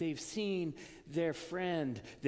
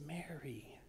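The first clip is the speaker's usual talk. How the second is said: soft whispered name; tender whisper; 7 dB quieter than usual. Acted. Jesus calling Mary by name, whispered. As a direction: breathe the name gently.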